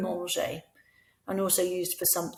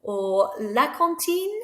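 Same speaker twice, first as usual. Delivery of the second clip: "Or la cantine" slow, rising higher to the end; slow rising question; 7 semitones higher, 3 dB louder, slower than usual. Shown as a demonstration. Teacher offering pupils "Or la cantine" with a slow rise. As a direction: draw "la cantine" out and lift it. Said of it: "just make your voice go up and it makes it a question".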